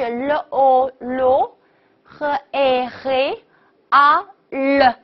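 "le o lo", "a he", "a le" slowly, piece by piece; sounded out. Shown as a demonstration. Teacher breaks down a brand name, slow syllable-by-syllable. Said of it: "连起来就是".

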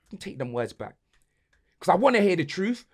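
ordinary talk

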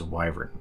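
"wyvern" with a long i, WHY-vern; correct long-i wyvern. Shown as a demonstration. Host repeating the correct way, long-i "wyvern". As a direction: pronounce the start of "wyvern" like "why". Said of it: "apparently the correct way is".

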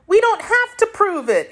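put-on little girl talking back to the sorcerer, mildly indignant; indignant Dorothy. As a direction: stress "to", high and put out. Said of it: "answered Dorothy indignantly".

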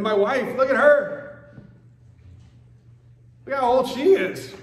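high, loud mock complaint; hammed-up grumpy old man; whining about his old wife; exasperated old Abraham. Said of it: "he's like".

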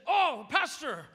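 high, drawn-out startled greeting; flustered apology. Retold or imitated. Stranger recognises the pastor at the car window, flustered.

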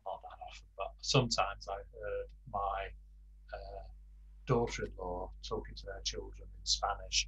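ordinary delivery

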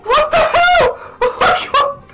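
high shrieking laughter; laughing shriek. Real, not acted. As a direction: squeal with laughter.

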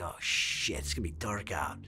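low, soft alarmed mutter; quiet dismay. Retold or imitated. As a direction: realize the danger under your breath. Waking to sunset alone.

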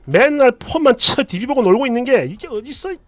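acted angry mother-in-law berating her daughter; furious scolding. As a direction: scold hard, voice climbing as it builds. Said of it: "이렇게 버럭버럭 하시더니".

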